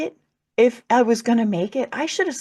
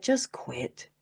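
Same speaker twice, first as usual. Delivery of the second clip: softer, hopeless voice telling her to give up; dark inner voice; 3 semitones lower, 6 dB quieter than usual. Retold or imitated. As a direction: murmur it like despair talking in your head. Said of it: "And it said".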